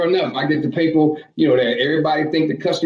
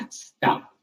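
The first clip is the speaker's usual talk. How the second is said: usual speaking voice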